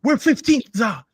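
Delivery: high, loud imitation of a fan's scream; screaming Troop. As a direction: yell it like the viral video rant. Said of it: "infamous video of Troop screaming".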